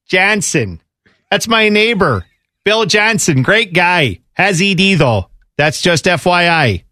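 lightly voiced Wisconsin accent; a hint of folksy Wisconsin neighbor. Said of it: "in a Wisconsin accent".

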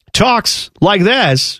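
very slow, drawn-out celebrity impression; halting, poorly imitated actor's cadence; bad Christopher Walken. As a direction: stretch each word in a badly faked impression. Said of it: "that was a bad Walken".

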